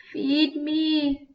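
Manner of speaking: high, strange plea of the fire; the dying fire cries out to the absent bears, high and eerie; crying out. A narrator's reading. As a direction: cry out for food. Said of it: "Fire cried".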